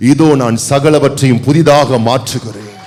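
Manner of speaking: booming shout of a divine promise; God proclaiming to Moses, shouting; shouted proclamation. Acted. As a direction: cry it out as God making all things new. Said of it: "God's proclamation".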